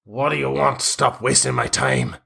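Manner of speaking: impatient brush-off in character; irritated bald man. Acted. Bald man to pestering stranger, impatient. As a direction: rebuff a time-waster curtly.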